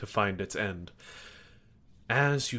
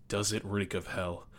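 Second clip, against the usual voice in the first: ordinary talk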